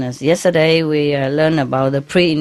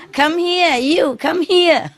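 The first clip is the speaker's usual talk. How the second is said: high parrot voice, slow at the end, laughing; bird calling; 12 semitones higher, much slower than usual. Retold or imitated. Teacher mimics her parrot calling her over, high and laughing. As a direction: call like a pet parrot summoning its owner. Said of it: "they say".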